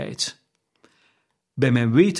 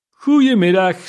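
higher, louder curt goodbye; sharp dismissal; 6 semitones higher, 7 dB louder than usual. A narrator's reading. Scrooge cuts off his nephew, higher and louder. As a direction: shut him down hard.

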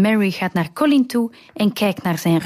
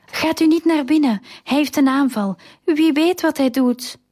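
higher-pitched, urgent storybook warning; anxious warning; 7 semitones higher than usual. A narrator's reading. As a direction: read it as a worried maid's warning. Maid warns girl during tantrum. (bezorgde waarschuwing, met hogere stem)

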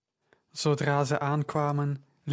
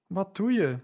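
a hint of desperation, pitched higher; desperate; 5 semitones higher than usual. A narrator's reading. Luke alarmed as Paul turns on the girl, mildly desperate. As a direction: ask it with rising alarm, lightly. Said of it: "met een wanhopige klank in zijn stem".

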